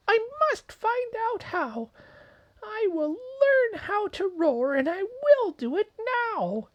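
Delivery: high, firm squeaky vow; a tiny mouse making a brave promise; determined little mouse. Acted. Mouse resolves.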